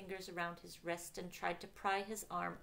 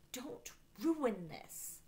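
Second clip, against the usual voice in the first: slow, higher-pitched warning; insistent warning; 6 semitones higher, much slower than usual. A narrator's reading. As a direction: tell him off, drawing it out. Warning him not to spoil it.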